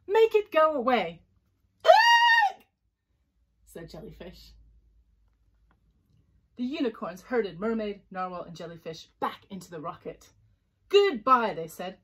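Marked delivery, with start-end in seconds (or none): 0.1-1.2 high, loud storybook order; hammed-up irritated command; annoyed demand. A narrator's reading. Unicorns demand the noise stops. 1.8-2.7 very high shrieked hiccup; piercing comic hiccup; loud hiccup. A narrator's reading. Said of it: "They were very loud hiccups". 10.9-11.5 loud, higher storybook goodbye; dismissive farewell. A narrator's reading. Unicorns dismiss the visitors.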